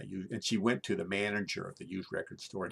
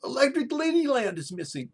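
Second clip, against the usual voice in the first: much higher, loud mock outrage; frantic yelling roommate; 11 dB louder than usual. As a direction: yell like a roommate who can't find his record. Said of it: "And he goes".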